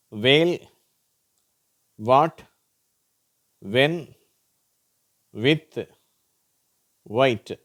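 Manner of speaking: "வேல், வாட், வென், வித், ஒயிட்" with w and silent h. Shown as a demonstration. Teacher showing silent h, "வேல், வாட், வென், வித், ஒயிட்" starting with w. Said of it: "உவ்வுன்னு உச்சரிக்கப்படுது".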